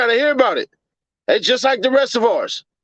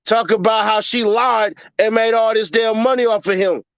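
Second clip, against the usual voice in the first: ordinary delivery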